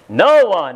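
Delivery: loud, punched "No one"; emphatic NO ONE. Shown as a demonstration. Preacher showing brethren the emphatic word, loud "No one". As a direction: hit "No one" hard, stretching "No". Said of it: "that's emphatic".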